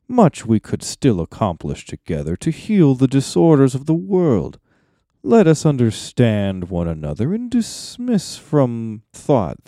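measured, swooping, theatrical wizard oratory; Saruman's persuasive speech. Acted. Saruman wooing Gandalf to his cause, smoothly persuasive.

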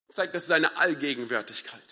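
ordinary talk, nothing marked

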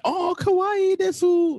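very high, drawn-out anime squeal; put-on high kawaii voice; cute anime girl. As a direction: squeal it like a cutesy anime streamer. Mimicking cute anime girl streamer.

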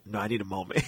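low, hushed retold plea; very soft, drained refusal, acted; weak plea. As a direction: let it sink like someone feeling sick. Sick teller pleading to sit.

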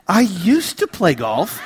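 "used to" leaned on heavily, slow; stress on used to. Retold or imitated. Kids asking about golf, heavy stress on "used to". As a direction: hit "used to" hard and slow. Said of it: "Heavy emphasis on the words "used to".

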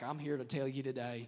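mock Southern evangelist, low; Southern preacher accent. New York evangelist faking a Southern accent, mock. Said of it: "They always had Southern accents".

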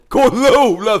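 loud, high gleeful call; happy, proud shout. Retold or imitated. Drunk friend calling to Ola near the security guards, proud happy shout. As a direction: show off proudly at the top of your voice. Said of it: "vilket han lyckligt och stolt".

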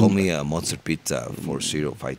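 put-on low, unhurried cartel boss in accented English. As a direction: speak low like a drug lord talking business. Narco voice plugging pizza number.

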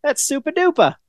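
high, easy mock Maine local voice; breezy imitation of a Maine accent; casual Maine drawl. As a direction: toss it off like a cheerful Maine ski guy. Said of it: "Said it so casually".

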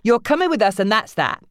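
mother laying down the law; angry mother. Acted. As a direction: end the argument. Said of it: "Nancy's voice shook with anger".

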